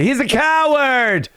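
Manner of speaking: high-pitched wailing accusation impression; Brendan. Mocking Brendan calling someone a coward, high-pitched imitation. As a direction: mimic an indignant rant way up in pitch. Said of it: "as Brendan".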